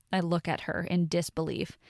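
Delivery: ordinary delivery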